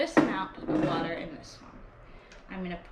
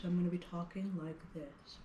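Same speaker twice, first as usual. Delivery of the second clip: lower, softer demo voice; really low pitch; 3 semitones lower, 7 dB quieter than usual. Shown as a demonstration. Music teacher showing children a low pitch, low soft voice. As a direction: drop down low to show the kids a low pitch. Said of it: "if I have a really low pitch".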